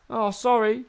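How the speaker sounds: high put-on mascot voice; hammed-up high-pitched sorry; in-character apology. Acts performer apologising in character.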